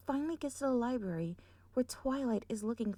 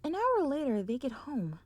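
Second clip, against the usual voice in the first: ordinary delivery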